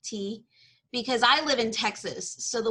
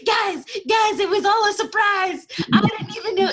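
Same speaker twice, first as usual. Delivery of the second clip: much higher and louder, gushing out a confession; giddy, high-pitched imitation of herself blurting it out; excited blurt; 11 semitones higher, 7 dB louder than usual. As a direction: spill the secret like an overeager kid. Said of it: "I get so excited".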